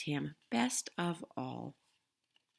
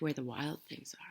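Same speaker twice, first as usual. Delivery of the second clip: whisper; 3 semitones lower, 8 dB quieter than usual. A narrator's reading. Reading softly to child.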